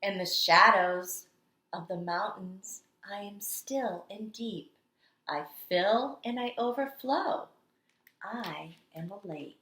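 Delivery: storytelling read-aloud. Acted. Teacher reading The Water Dance to kindergarteners, dramatic storytelling. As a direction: read it like the water itself is dancing. Reads picture book aloud.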